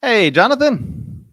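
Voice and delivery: high, slow, loud friendly hello; upbeat cold-call opener; energetic greeting. Real, not acted. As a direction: open the call big and warm. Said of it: "live call greeting".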